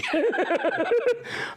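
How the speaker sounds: high burst of laughter; real high-pitched laugh; laughing at own joke. Real, not acted. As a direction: crack up after the joke.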